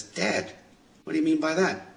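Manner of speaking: put-on high, soft voice of a boy; puzzled boy. Boy asks his dad to explain, imitated puzzled son. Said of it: "the boy says".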